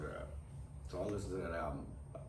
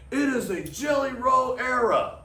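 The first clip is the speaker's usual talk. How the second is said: announcer; 12 dB louder than usual. Pretend. Quoting the WWE commercial line.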